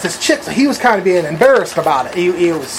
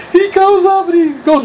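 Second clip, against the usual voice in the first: laughing; 10 semitones higher than usual. Real, not acted. Still laughing as story resumes.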